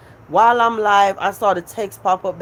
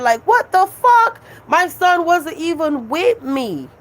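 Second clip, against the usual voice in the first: outraged; 7 semitones higher than usual. Real, not acted. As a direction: burst out at the critics. Angry outburst at critics.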